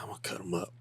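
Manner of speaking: whispered killer's thought; menacing whisper. Retold or imitated. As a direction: breathe the threat like a secret. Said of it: "He's like".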